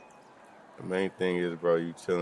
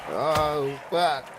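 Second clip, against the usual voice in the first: shocked yelp; 9 semitones higher than usual. Real, not acted. Knocked down in boxing game.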